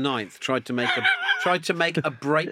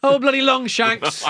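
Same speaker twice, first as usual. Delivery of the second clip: higher, louder farmer yelling in jest; mock angry farmer; 10 semitones higher, 7 dB louder than usual. Farmer yelling at him for milking Daisy, mock outraged.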